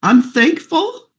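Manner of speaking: high, slow, choked-up thanks; fighting tears while saying thank you; tearful gratitude, voice breaking high. Real, not acted. Tearful thanks before near-crying.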